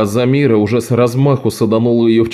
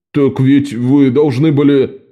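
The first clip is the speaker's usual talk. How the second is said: slow, angry objection; indignant, cut-off protest; 3 semitones higher, slower than usual. A narrator's reading. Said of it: "сердито заявил". (сердито, с возмущением)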